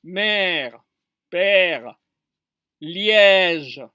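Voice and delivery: "Mère, père, Liège" with long, falling è; lengthened vowel demo. Shown as a demonstration. Teacher showing the longer French E, drawn-out "Mère, père, Liège". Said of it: "c'est un peu plus long".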